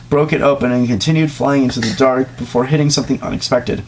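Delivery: normal talking voice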